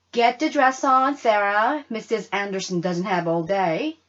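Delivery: brisk mother. Acted. Mama hurrying Sarah.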